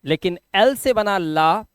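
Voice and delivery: ordinary talk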